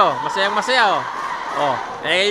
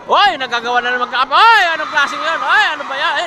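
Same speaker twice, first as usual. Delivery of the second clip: surprised; 7 semitones higher, 5 dB louder than usual. Real, not acted. Reacting to a bad play.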